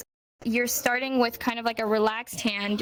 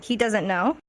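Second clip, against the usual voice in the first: raised questioning tone. Shown as a demonstration. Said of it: "if you do it up here".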